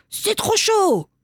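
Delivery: put-on high, drawn-out child's cry. A narrator's reading. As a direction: yelp in a high little-kid voice. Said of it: "lâche le bol et crie".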